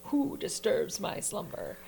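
low, slow rumbling statue voice; a hint of a looming stone guardian; grinding stone statue. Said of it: "Again, Disney, don't sue me".

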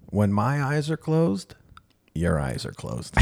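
slow, pointed, firm; deliberate rule. Retold or imitated. To therapist before closing eyes, slow pointed rule. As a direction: lay down the condition word by word.

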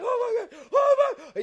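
high, loud mock announcer freak-out; hammed-up, high-pitched commentator shouting; excited announcer. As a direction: imitate a sportscaster losing it with excitement. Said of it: "he just gets so excited".

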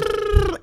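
high buzzing lip trill. Retold or imitated. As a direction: blow a childish high brrr. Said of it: "I was in the back of the car going".

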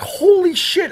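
high-pitched retold shock; startled exclamation. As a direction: blurt it high in alarmed excitement. Retelling his shock at the bite.

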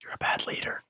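mock-scolding whispered aside; teasing whisper. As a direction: whisper it as a playful jab. Mock scolding, then says just kidding.

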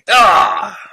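loud, high "ah" of exasperation; frustrated groan. Real, not acted. Host groans at botching his own joke, loud exasperated cry.

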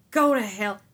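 Isaac lashing out at Judah, loud and angry; angry retort. Acted. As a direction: tell him to go to hell, loudly. Isaac rejects Judah angrily.

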